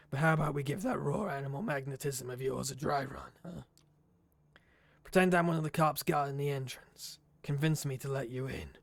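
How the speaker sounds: lightly voiced gruff old detective; a hint of a cigar-smoking Nosferatu contact; gravelly D'Angelo. D'Angelo hauling the player into the alley, lightly gruff.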